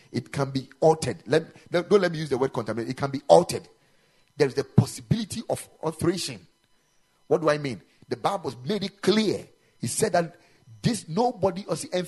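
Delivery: full-force pulpit delivery; forceful preaching. Real, not acted. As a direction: preach it, dragging each phrase.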